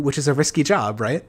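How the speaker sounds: usual speaking voice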